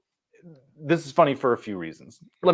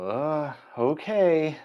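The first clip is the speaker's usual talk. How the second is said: drawn-out, higher-pitched reluctant agreement; hesitant parents; 4 semitones higher, much slower than usual. Acted. Parents reacting to their child's new houseguest, hesitant. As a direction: lightly act out wary parents going along.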